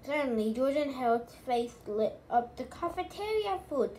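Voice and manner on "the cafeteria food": high-pitched exclamation at normal volume; shouted. A narrator's reading. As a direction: read it as a mild, higher-pitched shout. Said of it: "she shouted".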